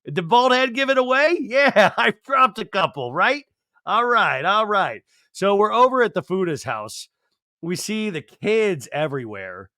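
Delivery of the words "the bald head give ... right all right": high, loud, swaggering tough-guy voice; a bald guy showing off his weight loss; proud John Fuda impression. John Fuda showing off his weight loss to the camera, high and loud. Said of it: "He's like".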